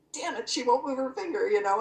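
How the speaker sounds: near-shout of frustration over a stuck finger; frustrated outburst. Real, not acted. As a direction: vent the frustration, loud and high.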